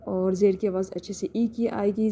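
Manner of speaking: ordinary talk, nothing marked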